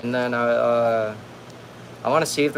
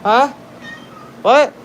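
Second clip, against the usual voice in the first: calling the cat; 12 semitones higher than usual. Real, not acted. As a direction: call out to the pet, rising.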